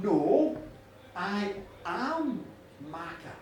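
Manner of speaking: low, weighty declaration with pauses; solemn goddess. Acted. Goddess correcting the farmer about her name, solemn and low. Goddess insists on who she is.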